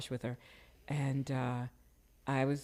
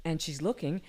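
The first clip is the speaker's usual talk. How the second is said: usual speaking voice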